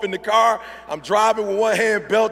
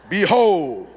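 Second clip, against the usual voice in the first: grand announcement; 5 dB louder than usual. Retold or imitated. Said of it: "steps back".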